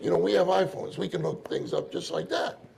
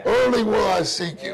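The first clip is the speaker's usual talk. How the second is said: fervent prayer; 4 semitones higher, 6 dB louder than usual. Shown as a demonstration. End of the prayer demo.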